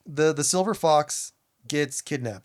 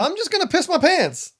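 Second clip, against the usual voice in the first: mock panicked squeal; 10 semitones higher than usual. Joking about torture scene.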